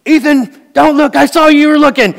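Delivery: put-on high, loud kid scolding his little brother; a big brother hissing a loud warning at the mall; bossy big brother. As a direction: play the older kid telling on Ethan. Older kid scolding younger brother.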